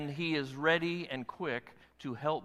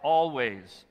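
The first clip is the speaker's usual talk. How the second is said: slow call and response; 5 dB louder, much slower than usual. Shown as a demonstration. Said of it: "Listen to what he says".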